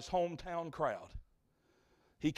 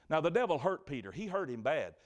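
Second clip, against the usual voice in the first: ordinary talk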